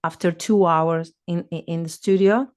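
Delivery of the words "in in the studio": usual speaking voice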